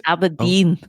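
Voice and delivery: Aberdeen said Scottish-style, abədin; self-conscious Scottish Aberdeen; Scottish accent. Pretend. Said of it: "In a Scottish accent".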